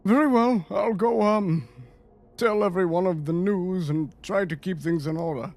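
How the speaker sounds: dutiful in-character underling; deferential subordinate, obliging. Acted. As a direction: accept the order from your lord. Plays the subordinate accepting orders.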